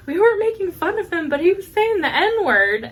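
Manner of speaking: put-on high, insistent kid's explanation; imitating protesting niece. Niece finally telling her aunt what happened, imitated protest. Niece defends herself in retelling.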